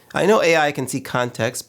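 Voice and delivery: normal talking voice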